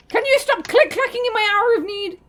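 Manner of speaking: a high, loud, annoyed complaint; exasperated outburst. Real, not acted. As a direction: snap at the noisy friend in despair. Irritated at friend's clicking.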